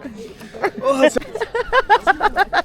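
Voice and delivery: bright high cackle; high laugh. Real, not acted. Joking about dirty friend.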